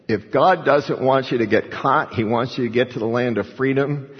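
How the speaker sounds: normal talking voice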